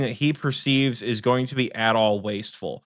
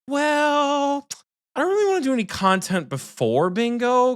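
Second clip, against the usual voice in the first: high whiny mock voice of Michael; mocking impression; 11 semitones higher than usual. Mocking Michael's excuse, in a high whiny voice. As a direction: mimic him, pitched way up and whining. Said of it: "he's gonna go".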